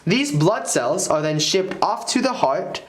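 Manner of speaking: ordinary delivery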